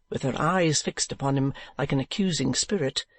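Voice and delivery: plain reading voice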